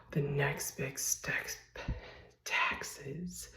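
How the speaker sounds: slow hushed whisper after a gasp; dramatic whisper. Acted. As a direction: breathe out the dreaded word. Dramatic reveal of dreaded taxes.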